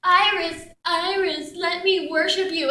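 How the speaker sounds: mock a doting worshipper's call; imitating devoted priest. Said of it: "he's always like".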